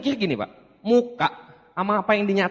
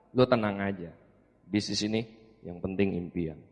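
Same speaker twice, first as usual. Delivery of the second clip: much lower and softer, unhurried and sure; calm older brother; 13 semitones lower, 10 dB quieter than usual. Retold or imitated. Older brother reassuring the doubting speaker about the business. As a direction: soothe him like the pitch can't fail.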